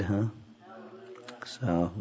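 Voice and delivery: ordinary delivery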